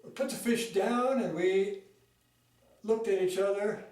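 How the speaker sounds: ordinary delivery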